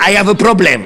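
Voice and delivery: Shakespeare with a put-on English accent; English accent, played for laughs; mock English accent. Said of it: "il parlait l'anglais avec cet accent".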